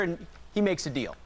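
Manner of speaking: ordinary talk, nothing marked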